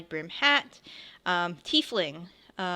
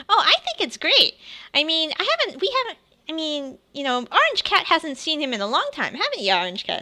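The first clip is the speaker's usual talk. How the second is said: put-on high, bright eager child; squeaky excited kid; 9 semitones higher, 5 dB louder, faster than usual. Child character eagerly speaks.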